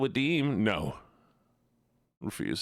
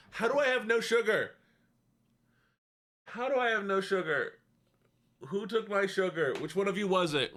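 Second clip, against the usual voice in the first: mock dismay; 8 semitones higher than usual. Discovers no sugar at home.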